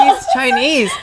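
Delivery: very high, laughing squeal; laughing exclamation. Retold or imitated. Telling mom about the boyfriend, high laughing exclamation. Excited retelling of introducing boyfriend.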